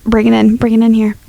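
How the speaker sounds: usual speaking voice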